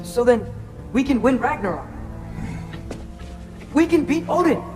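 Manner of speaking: thrilled, pitch climbing by the end; dawning excitement. Acted. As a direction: build from realization to eager triumph. Realizes they can win the war.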